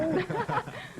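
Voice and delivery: short high laugh; a quick high-pitched laugh. Real, not acted. Host laughs at his joke.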